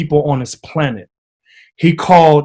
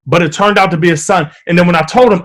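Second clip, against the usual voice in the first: ordinary talk, nothing marked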